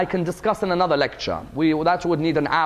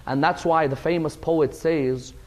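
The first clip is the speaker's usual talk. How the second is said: slow, hushed sermon lament; solemn, drawn-out telling of a tragedy; mournful lament; 4 dB quieter than usual. A narrator's reading. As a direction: recount the grief slowly, letting the voice sink softer. Mournful narration of Hussein's grief.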